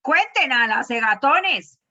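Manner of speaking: loud, drawn-out chiding; exasperated teasing scolding. Real, not acted. Teacher to class after wrong guesses, loud exasperated scolding. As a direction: call the class out, slow and loud.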